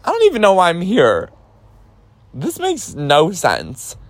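high, exasperated complaint; retold outburst of exasperated confusion; bewildered protest. Retold bewildered protest while handcuffed.

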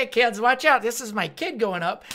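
loud, high imitation of a proud dad; eager dad. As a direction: call out like a dad filming his kid. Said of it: "like a fucking dad would".

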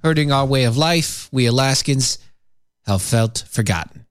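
reading the quote in a put-on Midwestern accent. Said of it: "I don't know what accent that was".

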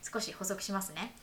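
clear, measured explainer read over music; walk the listeners through the system step by step; podcast narration. Acted. Host explaining the French arts subsidy to listeners, steady narration voice.

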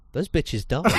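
half-laughing punchline. Real, not acted. Telling his co-host the ending, laughing. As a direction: laugh out the twist.

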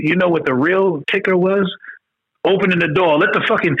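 ordinary talk

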